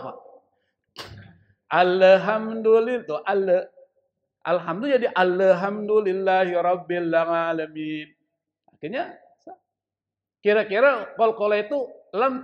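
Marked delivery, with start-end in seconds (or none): 1.7-3.0 dragged, bouncing echo vowel inside ALHAMDULILLAH; loud, slow "alhamdulillah" with extra bounced consonants; semua diqalqalahkan. Shown as a demonstration. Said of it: "Semua jadi qalqalah". 5.1-8.1 dragged recitation with a bounced L in "alhamdulillahirabbil"; semua diqalqalahkan. Shown as a demonstration. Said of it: "Semua jadi qalqalah".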